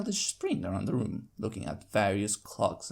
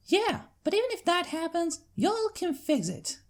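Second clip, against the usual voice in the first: put-on young filly cheering on, much higher; chiming filly. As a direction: chime in brightly as the kid sister. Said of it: "Apple Bloom chimes in".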